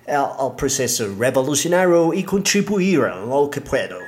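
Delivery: gringo speaking broken Spanish; American accent Spanish. Acted. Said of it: "disculpen mi español".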